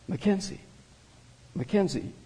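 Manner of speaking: soft, hushed name call; quiet, soothing murmur; whispered. A narrator's reading. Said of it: "she whispered".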